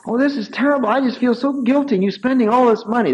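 lightly voiced fretting wife. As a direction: protest over and over, embarrassed. Wife's protest voiced in story.

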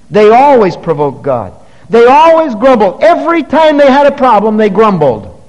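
preacher denouncing grumbling Israel; preaching at full force. Acted. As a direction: hammer the point home.

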